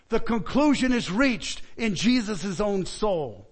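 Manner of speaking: normal talking voice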